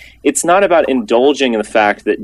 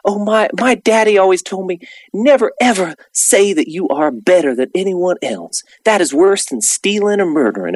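high, syrupy sentimental Southern lady; mock her teary sentimental apology, pitched high; Paula Deen; 8 semitones higher than usual. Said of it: "just in this sentimental voice".

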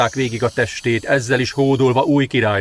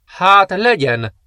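slow, lofty assent; 7 semitones higher, 4 dB louder, much slower than usual. Acted. Princess accepts the general.

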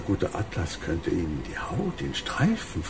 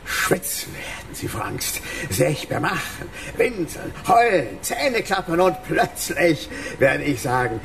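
steigt höher und lauter; wachsende Ekstase; 7 semitones higher than usual. Acted. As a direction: vom Raunen in den Rausch. Krug steigert sich in Wahn. (Bösewicht steigert sich in Vorfreude)

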